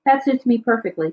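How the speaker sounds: usual speaking voice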